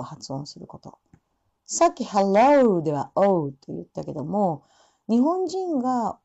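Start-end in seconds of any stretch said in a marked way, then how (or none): none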